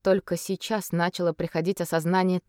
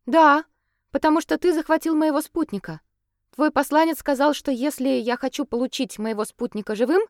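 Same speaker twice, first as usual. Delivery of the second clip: дерзко; 7 semitones higher, 4 dB louder than usual. Acted. Katerina defiant to Chernomor.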